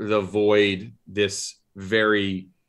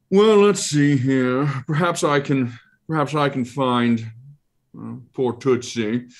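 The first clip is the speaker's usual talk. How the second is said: higher, fussy monster voice musing aloud; Ralakor creature voice; 5 semitones higher, 4 dB louder than usual. Acted. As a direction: muse like a pompous eye creature.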